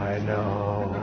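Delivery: low, soft, drawn-out "I know"; hushed, unruffled parent reply. Shown as a demonstration. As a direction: answer very quietly and evenly, voice dropped.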